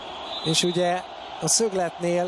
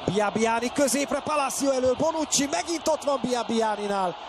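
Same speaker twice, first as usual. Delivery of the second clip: high excited play-by-play; rapid rising call in the box; excited scramble call; 7 semitones higher than usual. Real, not acted. Goalmouth scramble called live.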